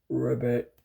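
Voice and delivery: low croaking ribbit; deep frog croak. Retold or imitated. Parent explains, big frog says it low. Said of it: "He probably said".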